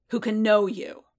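pressing. Acted. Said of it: "Boba pressed".

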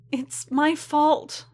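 high, slow, guilt-stricken voice; distressed self-blame. A narrator's reading. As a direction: drag it out high and upset. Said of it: "still wringing her hands".

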